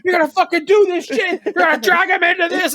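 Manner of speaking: high, loud mock shout at Brad; imitating angry yell. Audrey screaming at Brad for dragging him in, mock furious. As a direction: play an outraged girlfriend screaming.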